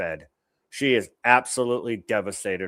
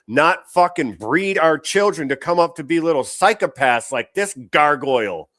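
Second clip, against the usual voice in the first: louder, higher furious outburst; angry rant; 6 semitones higher, 6 dB louder than usual. Real, not acted. Host condemns parents raising the kids, furious and loud. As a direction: let the anger spill out.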